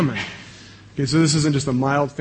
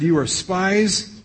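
slow, higher, each word of "you are spies" weighed; a stern governor pressing the accusation, lightly voiced; insisting slowly; 5 semitones higher, much slower than usual. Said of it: "But Joseph insisted".

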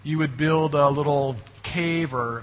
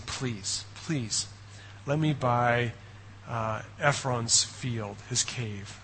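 soft, pleading voice, drawing out the words; quiet begging; 4 semitones lower, 8 dB quieter, much slower than usual. Retold or imitated. Preacher voicing Abraham imploring the Hittites, soft pleading. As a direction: beg them humbly and quietly. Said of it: "He's, he's begging".

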